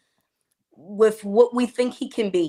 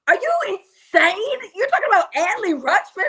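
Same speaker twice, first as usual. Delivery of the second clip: put-on incredulous boyfriend; 11 semitones higher, 4 dB louder than usual. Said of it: "and he looked at me like".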